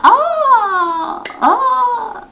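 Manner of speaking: high, long-drawn oohs; mock-amazed, sustained high cooing; imagined awed reaction. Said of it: "Je vais faire".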